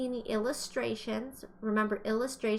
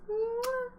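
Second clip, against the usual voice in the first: kiss sound; 13 semitones higher than usual. Pretend. Brain kiss for right answer.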